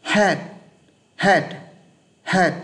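"Head" with a quick puff of air; breathy English head. Shown as a demonstration. Teacher modeling English head for students. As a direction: push the air out fast on "Head". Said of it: "họ phát âm này".

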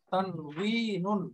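তানউইনুন drawn out slowly, long "wee" then "nun"; Arabic pronunciation. Shown as a demonstration. Teacher showing learners the Arabic word, slow Arabic pronunciation of তানউইনুন. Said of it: "আরবি লিখলে শব্দটা এরকম".

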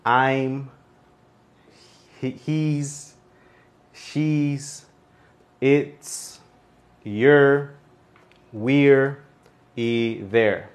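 each contraction said alone, with pauses between; pronunciation demo. Shown as a demonstration. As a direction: model "I'm", "he's", "she's" one careful syllable at a time. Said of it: "otra vez se lo digo".

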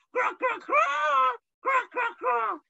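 very high, slow shouted craw; quail call. Retold or imitated. Preacher imitates his noisy male quails, high screech. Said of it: "Male quails make noises".

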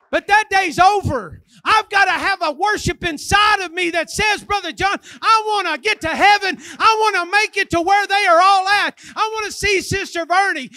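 loud, high-pitched revival preaching; full-force preaching. Real, not acted. Preacher urges the church to stand up for God, fervent shouting. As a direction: shout it out with mounting fervor.

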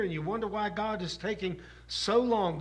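ordinary talk, nothing marked

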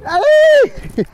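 high, loud squeal of delight; excited high "Hey!" to a beloved dog; excited greeting. Real, not acted. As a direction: greet her with a big happy shout.

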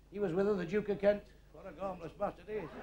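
ordinary talk

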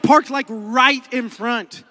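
normal talking voice

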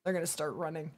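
ordinary talk

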